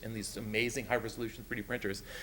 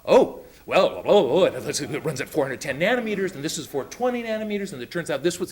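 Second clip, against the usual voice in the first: louder, higher mock rush of technical talk; imitating the student; 4 semitones higher, 9 dB louder than usual. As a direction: rattle off the specs like an excited nerdy kid. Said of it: "He went".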